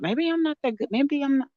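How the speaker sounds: small, high, soft voice of self-doubt; self-doubting thought. Retold or imitated. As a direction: think it aloud, high and hushed, unsure of yourself. Retells her own doubting thought.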